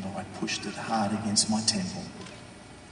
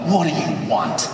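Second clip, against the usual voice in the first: raised, irritated demand; exasperated snap; 5 semitones higher, 7 dB louder than usual. Acted. Husband picks up his wife's call, exasperated demand. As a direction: answer the phone fed up, louder and higher. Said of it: "I'm like".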